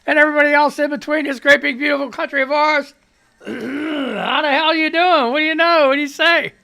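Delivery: jovial radio announcer hello; radio host greeting. Acted. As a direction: greet the whole country like a DJ.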